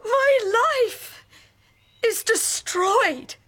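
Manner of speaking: high, slow tearful shout; crying shout. Acted. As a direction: cry out while being carried. Wife carried off, crying out.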